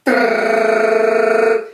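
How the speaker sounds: loud rolled trill imitating the spoon whipping; imitated spoon-whipping trill; whipping sound. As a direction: rattle out a fast rolled r, high and loud. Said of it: "she'd go".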